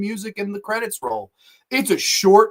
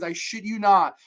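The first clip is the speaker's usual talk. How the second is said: usual speaking voice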